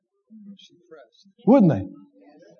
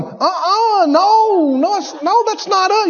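whiny inner voice; 9 semitones higher than usual. Retold or imitated. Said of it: "your head's going".

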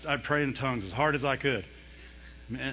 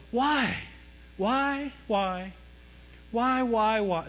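higher-pitched, repeated pleading whys; plaintive whys; 5 semitones higher, much slower than usual. Retold or imitated. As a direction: ask why over and over, imitating a complainer. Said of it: "You know, you have people go".

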